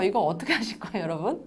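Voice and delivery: usual speaking voice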